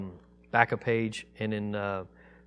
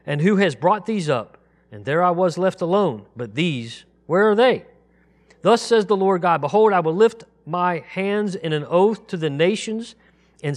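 raised, louder measured Bible reading; scripture reading; 6 semitones higher, 6 dB louder than usual. Acted. Teacher reading Isaiah aloud to the congregation, solemn raised reading. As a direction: proclaim each verse solemnly, slowing on the names of God.

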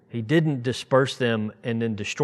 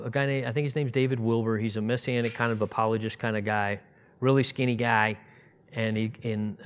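ordinary talk

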